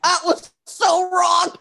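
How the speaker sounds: high, drawn-out wailing shout; amused outrage. Real, not acted. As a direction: cry it out in scandalised disbelief. Mock outrage at a quoted line.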